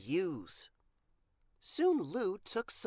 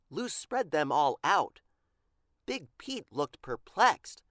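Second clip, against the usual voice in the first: ordinary delivery, nothing marked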